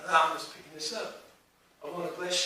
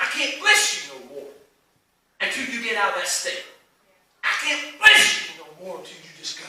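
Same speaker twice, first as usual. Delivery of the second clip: higher, louder voice of God, firm and insistent; voicing God; 4 semitones higher, 12 dB louder than usual. Preacher voicing God telling the congregation to move, firm. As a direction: lay down God's terms with a laugh in it. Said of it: "And God's like".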